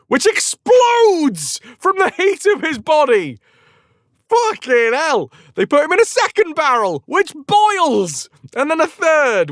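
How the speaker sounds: high, hammed-up amazement; over-excited narration. A narrator's reading. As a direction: tell it at a high excited pitch. Excited telling of exploding barrels.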